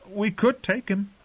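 high timid squeak. A narrator's reading. Sam blurting a timid offer to the wildling, squeaking. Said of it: "someone squeaked".

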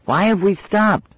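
put-on very high little-girl demand; demanding child. A narrator's reading. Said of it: "demanded the suspect".

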